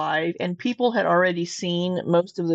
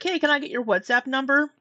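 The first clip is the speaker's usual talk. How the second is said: higher-pitched quoted request; imitated chat request; 6 semitones higher than usual. Retelling a stranger asking for a number, lightly mimicked. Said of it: "she's like".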